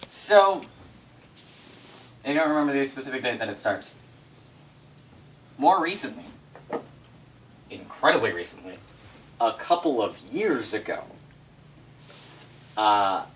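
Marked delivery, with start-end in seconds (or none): none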